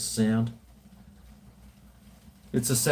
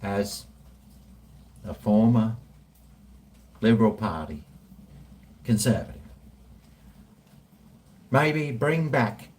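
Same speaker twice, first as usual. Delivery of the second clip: ponderous speech, a few words at a time; Tony Abbott. Acted. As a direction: drag it out with long pauses between phrases. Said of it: "Do a Tony Abbott".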